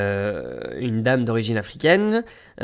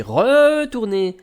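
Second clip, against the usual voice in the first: "retourné" stretched long and pitched up; drawn-out word; 8 semitones higher, 4 dB louder, much slower than usual. Real, not acted. Traveller telling of trudging back, "retourné" stretched high and slow. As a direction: drag out the first syllable of "retourné".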